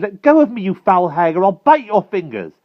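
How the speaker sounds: high, loud struggling threat; defiant cry. A narrator's reading. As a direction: shout as you fight the grip. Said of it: "he cried".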